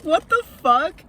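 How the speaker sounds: loud, slow, stunned "what the fuck"; shocked outburst. Retold or imitated. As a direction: blurt it out in shock. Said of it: "I was like".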